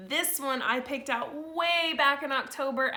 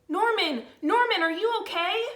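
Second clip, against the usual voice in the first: higher, anxious calling; worried snails; 9 semitones higher, 4 dB louder than usual. Acted. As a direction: call out to a friend who fell. Snails check on fallen Norman.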